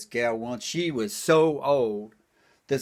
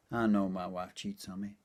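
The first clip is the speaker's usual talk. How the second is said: normal talking voice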